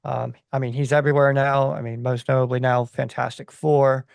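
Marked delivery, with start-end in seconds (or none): none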